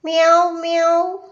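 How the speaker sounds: imitated kitten's cry, rising at the end; voiced little cat mew; kitten meowing. A narrator's reading. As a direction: meow like a crying kitten. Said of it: "കുഞ്ഞി കരയുന്നത്".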